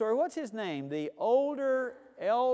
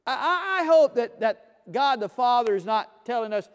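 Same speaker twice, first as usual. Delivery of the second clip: ordinary delivery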